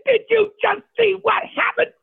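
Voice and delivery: high, excited, half-laughing rush; can you believe this just happened; giddy disbelief. Retold or imitated. Chef to his buddy outside, high giddy disbelief.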